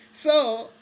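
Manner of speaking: high burst of laughter, real and delighted; excited laugh. Real, not acted. Telling viewers the punchline, laughing with real excitement. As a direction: break into a giddy laugh. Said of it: "excuse my excitement".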